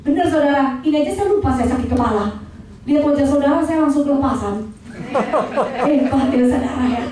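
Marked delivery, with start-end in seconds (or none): 4.6-5.9 soft chuckle. Real, not acted. Preacher laughs at herself.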